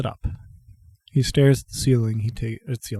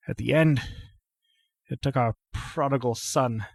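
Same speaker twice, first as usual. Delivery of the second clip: fury turned inward; 2 semitones higher, 5 dB quieter than usual. Acted. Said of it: "feel his fury sort of turn on itself".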